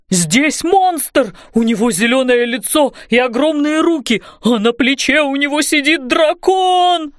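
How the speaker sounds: hammed-up frightened man's voice; panicked wailing. A narrator's reading. Roofer reporting a monster to all, wailing in panic. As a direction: wail the alarm in a put-on voice, rising and stretching at the end. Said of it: "завопил он громко".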